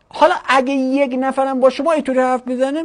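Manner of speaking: ordinary talk, nothing marked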